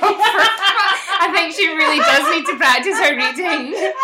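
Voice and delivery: high, loud, slow, laughing; laughing tease. Real, not acted. Teasing a friend about her reading, laughing.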